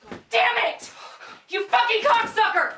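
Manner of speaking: high, loud raging yell; furious shout. Acted. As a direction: explode with rage. Furious outburst at Ben.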